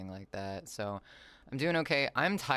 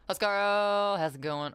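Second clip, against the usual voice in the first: excited greeting; 9 semitones higher, 8 dB louder, slower than usual. Real, not acted.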